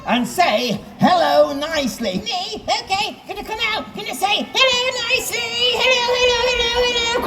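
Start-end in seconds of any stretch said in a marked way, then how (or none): 2.2-7.2 high squeaky ventriloquist dummy voice; Charlie the puppet. Acted. Ventriloquist gives his dummy a high, timid voice. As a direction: pipe up high as the shy dummy.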